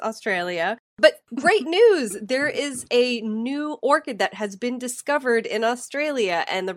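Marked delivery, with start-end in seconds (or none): 1.0-4.2 excited. Acted. Cheerful news announcement.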